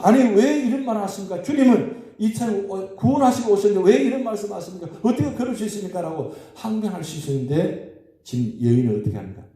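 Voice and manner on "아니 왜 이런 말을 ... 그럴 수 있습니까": raised, high-pitched protest, faster at the end; imitating an indignant listener's protest. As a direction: hurl the objection back at the Lord. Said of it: "항변할 수 있죠".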